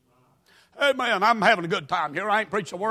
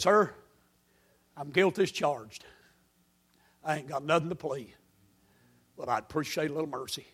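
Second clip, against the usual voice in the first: 1 humble plea; 6 semitones lower, 10 dB quieter than usual. Retold or imitated. Pleading to a trooper.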